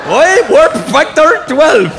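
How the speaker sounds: slow, high, loud mock Scottish accent; Scotty. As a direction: stretch it out in mangled Scottish. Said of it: "Scotty in Star Trek".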